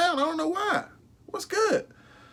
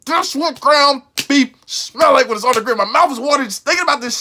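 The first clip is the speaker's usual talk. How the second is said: raving; 3 semitones higher, 4 dB louder than usual. Real, not acted. Raving about the smell of beef.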